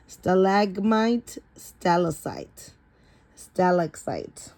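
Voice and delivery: "Stalagmite, stalactite" said slowly, each syllable clear; careful pronunciation. Shown as a demonstration. Reader teaches the child the words, slow and clear. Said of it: "how to remember which is the word".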